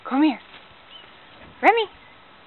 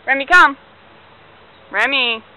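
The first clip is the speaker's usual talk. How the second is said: loud call, punching come; loud command; 11 dB louder than usual. Real, not acted. Owner calling dog back, loud firm command. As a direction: call the dog firmly across the yard.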